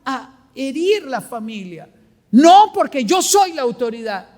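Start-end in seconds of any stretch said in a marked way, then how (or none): 2.3-4.3 authoritarian parent. Retold or imitated.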